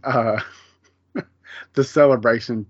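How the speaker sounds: ordinary talk, nothing marked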